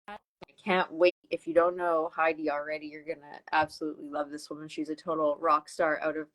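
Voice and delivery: ordinary talk, nothing marked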